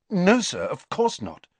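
hurried, high start that settles down; quick placating reply. A narrator's reading. Pupil hastily reassures offended professor, quick. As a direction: jump in quickly to appease. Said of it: "said Riddle quickly".

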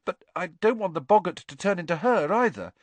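put-on a nervous boy pleading; anxious schoolboy Neville. A narrator's reading.

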